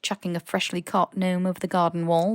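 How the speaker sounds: plain reading voice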